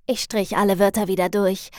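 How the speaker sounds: plain reading voice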